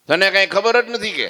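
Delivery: high, loud scolding; indignant shout. Acted. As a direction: snap it at him. Sadhu scolds Maharaj. (ગુસ્સાભર્યો સવાલ)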